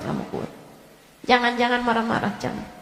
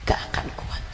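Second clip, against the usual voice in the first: a soft, breathless whisper; whispered aftershock; whispered echo; 5 dB quieter, faster than usual. Acted. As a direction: let the warning sink in quietly. Echo after a long pause.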